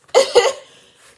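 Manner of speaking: cracking up at herself; sudden shrieking laugh; loud laugh. Real, not acted. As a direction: burst of high laughter. Laughs at own narcissism.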